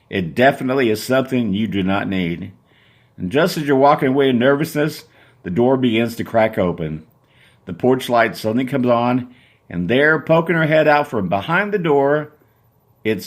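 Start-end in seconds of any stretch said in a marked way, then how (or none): none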